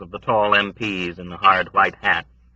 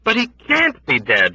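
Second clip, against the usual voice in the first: insistent cry; 11 semitones higher, 3 dB louder than usual. A narrator's reading. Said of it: "Yossarian cried".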